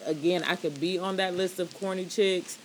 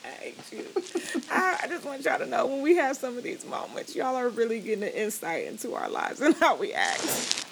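laughing; 6 semitones higher than usual. Real, not acted. Still laughing through words.

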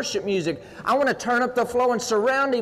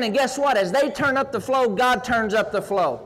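ordinary talk, nothing marked

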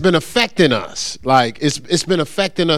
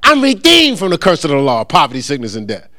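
high-pitched, defiant declaration; talking back; 5 semitones higher than usual. Acted. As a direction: fire scripture back at the sickness. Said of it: "talk back to the pain and sickness".

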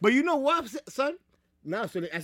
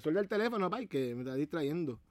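Puerto Rican Spanish, softer and lower; Puerto Rican accent; 3 semitones lower, 6 dB quieter than usual. Pretend. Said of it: "You see how I flipped that sound Puerto Rican?".

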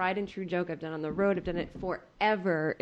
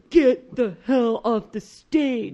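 louder, slow dismissive shout; mock yell; 2 semitones higher, 8 dB louder, much slower than usual. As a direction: drive her off stage. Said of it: "Alonzo Bowden goes".

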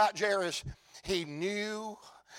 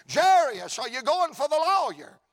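calling bystander; 6 semitones higher, 4 dB louder than usual. Acted.

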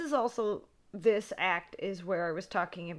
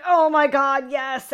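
loud, higher excited exclamation; thrilled reaction; 6 semitones higher, 12 dB louder, slower than usual. Retold or imitated. Fan reliving Laurie's fall from the window, thrilled. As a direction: burst out with joy.

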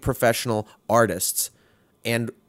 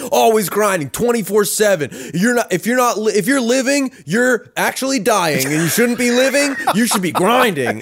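hammed-up hustle guru, pitched way up; mock motivational rant, high and forceful; Gary Vee parody; 10 semitones higher, 4 dB louder than usual. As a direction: lampoon a relentless grind preacher. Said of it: "make fun of that Gary Vee kind of nonsense".

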